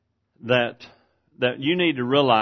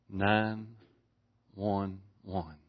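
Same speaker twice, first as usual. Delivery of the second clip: quiet retold call; 5 semitones lower, 10 dB quieter, much slower than usual. Retells woman's emergency call.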